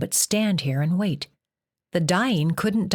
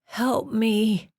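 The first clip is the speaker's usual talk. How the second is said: tearful; 5 semitones higher than usual. A narrator's reading. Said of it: "tears welled in his eyes".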